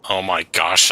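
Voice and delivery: usual speaking voice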